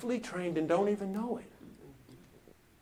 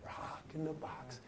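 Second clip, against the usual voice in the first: very soft, low, intimate refrain; hushed preaching; 3 semitones lower, 12 dB quieter than usual. Acted. Preacher likens the crowd to Johnny, hushed refrain. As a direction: bring it right down to the listener.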